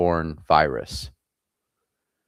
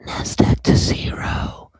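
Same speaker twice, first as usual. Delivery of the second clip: slow breathy whispered brand name; sultry perfume ad; 5 dB louder, slower than usual. Pretend. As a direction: whisper it like a fragrance ad. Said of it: "That sounds like a perfume commercial".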